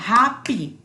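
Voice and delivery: "happy" slowly split into two syllables; two-syllable demonstration. Shown as a demonstration. Teacher shows students the two syllables, "happy" split slowly. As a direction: say "happy" in two parts, hap-py. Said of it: "Dice dos sílabas".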